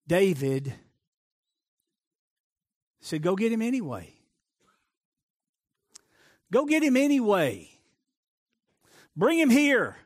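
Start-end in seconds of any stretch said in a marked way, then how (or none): 3.2-10.0 commanding call. Acted. David commanding servants, rising.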